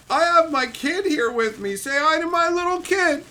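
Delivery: high-pitched mocking imitation of a proud parent; goofy dad. Dad showing off his kid to a streamer, mocked goofy voice. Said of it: "people get goofy over their kids".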